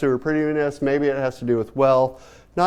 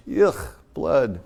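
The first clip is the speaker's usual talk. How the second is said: mock disgust; 5 semitones lower than usual. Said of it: "She's doing this".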